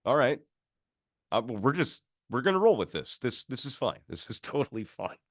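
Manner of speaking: nervously talking yourself into calm; baffled onlooker. Retold or imitated. Narrator reacts to the guide petting a ghost, flustered. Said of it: "watching this guy going like".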